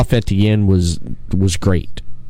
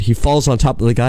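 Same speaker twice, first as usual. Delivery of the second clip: ordinary delivery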